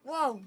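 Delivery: loud sudden wow; startled yelp. Real, not acted. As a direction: jump and cry out. Startled in game.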